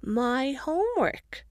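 slow, high wobbling little voice; warbling child. Acted. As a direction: draw the word out like a whining toddler. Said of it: "Sophie begins to warble".

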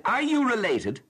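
loud, high mock accusing question; fired question. Clerk interrogating two friends, hammed-up accusing tone. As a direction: fire it at her like a charge. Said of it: "The question was positively fired at me".

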